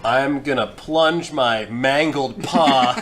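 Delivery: ordinary delivery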